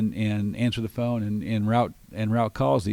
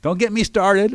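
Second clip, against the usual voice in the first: exasperated; 10 semitones higher, 6 dB louder than usual. Real, not acted. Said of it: "exasperated aside".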